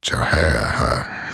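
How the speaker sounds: slow, loud odd-timbred cackle; a cartoon creature's laugh. Ending her impression with a slow loud creature laugh. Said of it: "the only voice impression I can kind of do".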